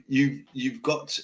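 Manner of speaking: ordinary talk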